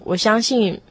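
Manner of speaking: ordinary talk, nothing marked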